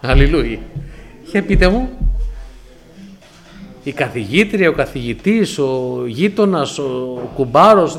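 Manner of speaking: loud, drawn-out preacher's call; rally the congregation from the pulpit; preaching at full force. Acted. Preacher to the congregation, loud rallying call.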